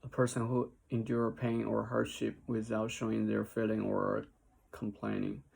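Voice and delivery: ordinary delivery, nothing marked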